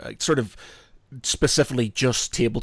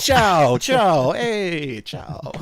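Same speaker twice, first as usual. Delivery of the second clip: hammed-up Italian greeting, high and loud; playful fake Italian ciao; mock Italian accent; 6 semitones higher, 6 dB louder, much slower than usual. Joking about Italians, a mock Italian accent. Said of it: "that's my sort of Italian slash New York Italian accent".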